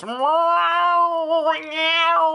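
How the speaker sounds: high, loud strangled cat yowl; mock moose call that comes out as a dying cat; shrill, cartoonish meowing, hammed up for laughs; dead cat meow. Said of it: "It sounds like a dead cat".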